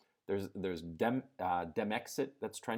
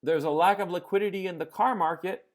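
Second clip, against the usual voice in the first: mock absurd claim; 9 semitones higher, 6 dB louder than usual. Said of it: "Do you hear me say".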